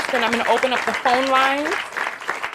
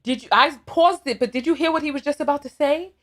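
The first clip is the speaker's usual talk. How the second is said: usual speaking voice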